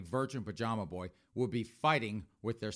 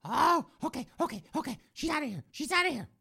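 meek high voice; faster than usual. Acted. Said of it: "That virgin pajama boy be like".

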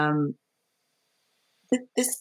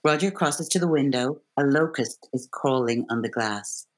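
measured read of stage directions; calm stage-direction read; script stage directions; 3 semitones lower than usual. Acted. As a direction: set the scene like a script reader.

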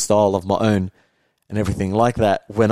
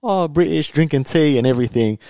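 mock posh British; 6 semitones higher than usual. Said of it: "it was just like".

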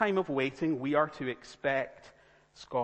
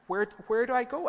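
high-pitched bewildered stammer; acted confusion; 8 semitones higher, 4 dB louder than usual. Arriving traveller given conflicting directions, acted bewilderment. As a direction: ask it lost, voice jumping up. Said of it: "It can be confusing".

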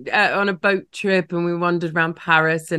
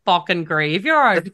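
put-on Northern Irish accent; slower than usual. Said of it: "thick Northern Irish accent".

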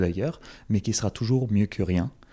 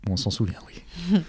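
whispered aside; 8 semitones higher, 4 dB quieter than usual. Real, not acted. Wistful memory of referendum night.

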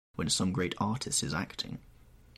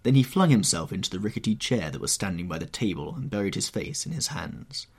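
plain reading voice

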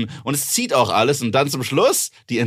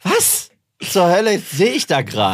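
high, loud stunned outcry; what the hell am I seeing; shocked disbelief; 7 semitones higher, 5 dB louder than usual. Retold or imitated. Retells shock at film scene.

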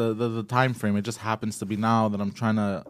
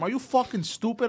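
high-pitched mock outburst; half-mock furious question; retold angry snap; 10 semitones higher, 4 dB quieter than usual. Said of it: "And I go to him".

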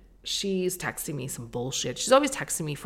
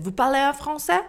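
louder, higher French question; boy in French; 9 semitones higher, 6 dB louder than usual. Retold or imitated. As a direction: mimic the boy's surprised French.

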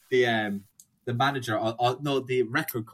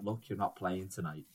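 lower, much softer stern refusal; gruff venue owner; 5 semitones lower, 12 dB quieter than usual. Retold or imitated. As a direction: block the door like a grim bouncer. Venue owner turns band away.

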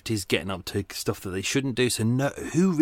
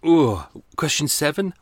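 hiccup breaking the words; talking through hiccups; 4 semitones higher, 4 dB louder than usual. Real, not acted. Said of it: "I'm hiccuping".

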